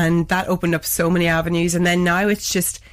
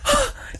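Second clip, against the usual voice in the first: mock gasp; 3 dB quieter than usual. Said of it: "He's like".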